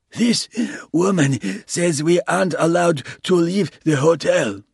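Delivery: pompous merchant complaining loudly; indignant Lebanese man. A narrator's reading.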